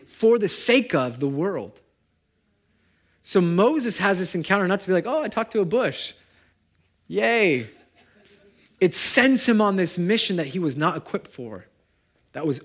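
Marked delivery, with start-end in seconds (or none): 5.1-7.7 high, sarcastic fake delight; mock cheer. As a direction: parody a shallow thrill, sing-song. Mocking a shallow Moses.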